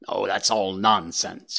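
usual speaking voice

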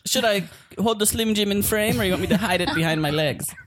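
normal talking voice

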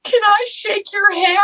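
high, drawn-out mock blubbering; tearful request. Star-struck fan asking Chris Carter for a handshake, tearful whine. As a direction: squeak it out slowly through imitated tears. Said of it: "I just can't stop crying".

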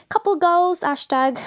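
high-pitched hashtag squeal; playful put-on high voice; mock selfie gushing. Mimicking cute selfie captions.

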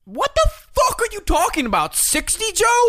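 high, loud indignant outburst; outraged disbelief. Real, not acted. Guest reacts to being called sixty, high indignant outburst. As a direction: squeal it like you're insulted.

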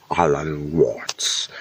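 slow whispered Alan Watts; whispered accented Alan Watts; whispered name. Shown as a demonstration. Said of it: "I wanna say it in the accent".

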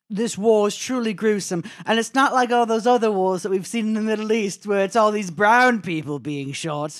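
high, loud mock reporter delivery; mock news reporter. Mocking reporters on the war, mock-grave broadcast voice. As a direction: parody an outraged TV correspondent. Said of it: "all these reporters, too, are like".

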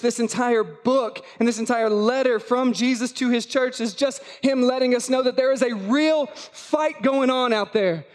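ordinary talk, nothing marked

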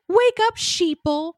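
high-pitched, drawn-out mock alarm call; mock rallying cry. Co-host reading out a ranting sin script, mock rallying cry. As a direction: call it out slow and high, like a hammed-up warning.

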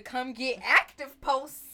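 loud, drawn-out mock hype; mocking callout. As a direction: belt it out slowly like a fight challenge. Mockingly quotes daughter's fighting posts.

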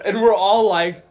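ordinary delivery

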